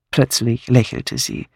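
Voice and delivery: plain reading voice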